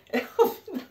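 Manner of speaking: high, wheezy laughing fit; real squealing laughter; helpless laugh. Real, not acted. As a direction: crack up until out of air. Recalls laughing herself flat.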